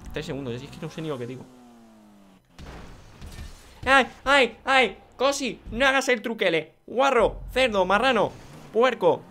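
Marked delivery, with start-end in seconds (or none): 3.9-9.2 high, loud string of insults; indignant scolding. Real, not acted. Gamer scolding Cosi for using a cheap trick, indignant yelling. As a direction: yell each insult separately, high and loud.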